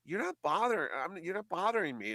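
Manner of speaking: Larry's high insistent reassurance; imitating Larry. Larry telling the host he is no bother, high and insistent. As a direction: brush off the worry, pitched high. Larry voiced in retold chat.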